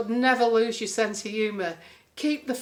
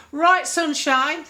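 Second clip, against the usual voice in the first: loud, higher, slow northern English "Right, sunshine"; northern accent; 6 semitones higher, 8 dB louder, much slower than usual. Retold or imitated. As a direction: drawl it like a brash northern entertainer. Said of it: "like, northern accent".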